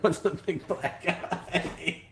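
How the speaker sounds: laughing through the words; words broken by laughter. Real, not acted. Reading a funny comment aloud.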